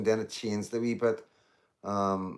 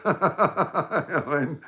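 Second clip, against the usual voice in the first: laughing; 7 semitones higher, 6 dB louder, slower than usual. Real, not acted. Laughing at own hurt feelings.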